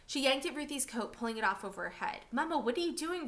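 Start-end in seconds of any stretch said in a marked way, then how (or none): 2.3-3.3 put-on child crying out. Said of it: "Ruthie cried".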